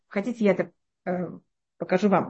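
usual speaking voice

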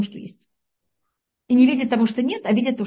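normal talking voice

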